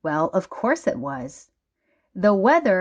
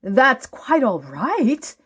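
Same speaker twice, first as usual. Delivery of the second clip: delighted wonder; 5 semitones higher, 3 dB louder than usual. Acted. Savouring the kind words.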